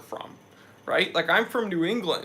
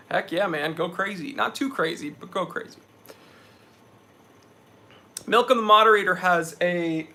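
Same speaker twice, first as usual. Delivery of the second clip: ordinary talk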